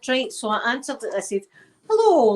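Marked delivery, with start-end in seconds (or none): 1.9-2.3 bright, high, loud hello; mock phone hello. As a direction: answer the phone sing-song. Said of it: "like that".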